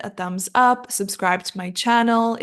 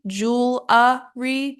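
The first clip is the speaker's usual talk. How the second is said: American, syllable by syllable; 3 semitones higher, much slower than usual. Shown as a demonstration. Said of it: "either in American English".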